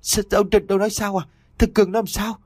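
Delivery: high-pitched, stammered disbelief; stammering shock. A narrator's reading. Mother asks what happened to her son, stammering in shock. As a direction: stumble over the words in alarm, voice jumping up high. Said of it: "cố gắng lắp bắp xác nhận lại".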